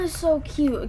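normal talking voice

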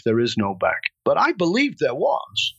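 ordinary delivery

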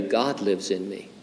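softly, like sharing a secret; hushed secret. Shown as a demonstration. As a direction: drop the voice for a confidence. I have a secret to tell you.

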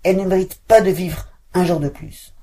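loud, high threat; enraged witch vowing death; menacing. Acted. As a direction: condemn the girl. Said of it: "tempêta Baba Yaga".

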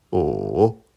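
O dipping low then climbing back up; third tone on O. Shown as a demonstration. Teacher showing learners Mandarin O, dipping tone. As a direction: draw O down and up again. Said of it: "Je les répète".

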